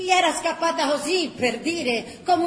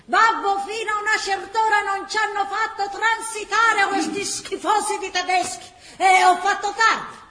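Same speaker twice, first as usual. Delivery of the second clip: excuse to father; 7 semitones higher, 6 dB louder than usual. Acted. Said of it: "aveva già pronta la scusa".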